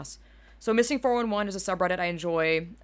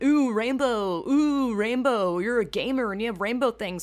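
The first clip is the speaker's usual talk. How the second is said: dazzled mock gamer; 4 semitones higher, 5 dB louder than usual. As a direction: tease gamers like a hypnotised kid.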